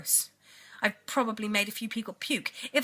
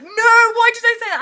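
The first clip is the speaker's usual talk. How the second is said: high, loud cry of disbelief, half laughing; horrified squeal; 14 semitones higher, 13 dB louder than usual. Retold or imitated. Telling the car story of swearing at mum, a loud horrified squeal. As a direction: shriek at your own slip of the tongue. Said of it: "and then I was like".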